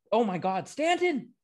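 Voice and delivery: high-pitched real cry of excitement; excited outburst. Real, not acted. Host sees Stanton's hit mid-show, real excited outburst. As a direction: erupt mid-sentence, ending on a long, loud high "oh". Reacts live to baseball play.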